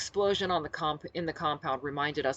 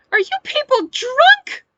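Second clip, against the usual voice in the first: very loud, drawn-out shrieked demand; high-pitched shriek; 16 dB louder, slower than usual. A narrator's reading. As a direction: shriek it slowly, far up in pitch. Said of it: "demanded in a high-pitched shriek".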